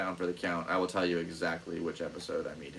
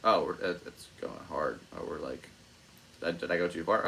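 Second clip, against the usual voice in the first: ordinary talk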